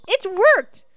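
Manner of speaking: high, loud burst of joy; joyful cry. Acted. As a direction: cheer at the success. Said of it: "clapped her hands in joy".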